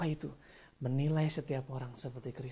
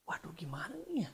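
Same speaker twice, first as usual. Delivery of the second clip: worried muttering to self; 4 semitones higher, 10 dB quieter than usual. Retold or imitated. Voicing his past worry over fees.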